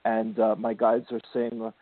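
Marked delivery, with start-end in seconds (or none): none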